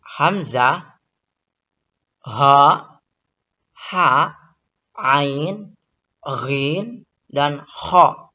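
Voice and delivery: each throat letter spoken out, "hamzah, ha, ha, ain, ghin, dan kha"; letters pronounced clearly. Shown as a demonstration. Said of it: "Huruf halqi ada enam". (huruf halqi diucapkan satu per satu dengan jelas)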